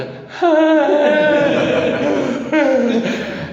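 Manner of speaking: high burst of laughter. Real, not acted. Laughs recalling drugged flight.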